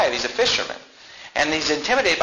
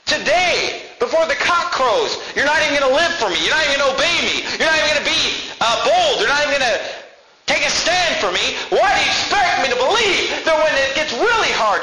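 fiery preaching; 10 semitones higher than usual. Acted. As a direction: drive it home hard to the congregation. Preacher in full force voicing Jesus.

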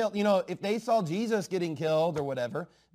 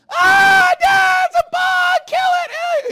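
much higher, much louder panicked shriek; imitating screaming kids; 17 dB louder, much slower than usual. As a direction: shriek like a terrified child at a bug. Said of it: "they're all scared and they're screaming".

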